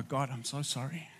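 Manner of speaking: soft retold apology.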